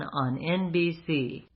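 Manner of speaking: ordinary talk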